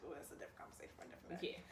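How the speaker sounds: hushed, fast throwaway line; whispered aside. Real, not acted. Brushing off a topic for another day, whispered quickly.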